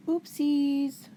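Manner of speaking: mock oopsie. Host mocking Patty's mix-up over who said it, in a teasing oopsie. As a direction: tease her mistake like a child's slip.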